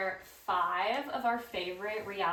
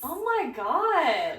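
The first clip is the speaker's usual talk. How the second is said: high, loud, slow "oh my God"; floored, swooping up high; astonished; 6 semitones higher, 7 dB louder, much slower than usual. Real, not acted. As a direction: stretch it out in real shock. Listener reacts to shocking story.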